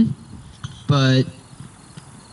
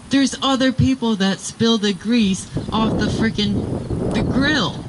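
higher-pitched retold argument; defensive protest; 6 semitones higher than usual. Retelling a defence over spilt grease, protesting. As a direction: argue back, pitch raised.

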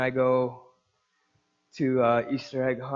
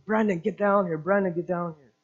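higher-pitched summons, retold; imitated calling out; 5 semitones higher than usual. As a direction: call someone over, imitating an impatient relative. Ex-father-in-law calling him over.

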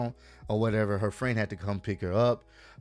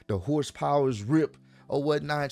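usual speaking voice

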